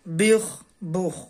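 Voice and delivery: بخ، بخ drawn out slowly, each sound separate; slow syllable drill. Shown as a demonstration. Teacher slowly sounding out بخ for a child learning to read. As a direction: spell out بخ، بخ slowly for the pupil. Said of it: "لہذا اسے پڑھیں گے".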